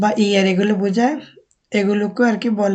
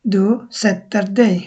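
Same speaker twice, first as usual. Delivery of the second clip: jag sätter mig spoken very slowly, word by word; slow careful model sentence for learners; slow Swedish demo; much slower than usual. Shown as a demonstration. Teacher modelling a Swedish sentence for students, very slow. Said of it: "তুমি বসতেছ".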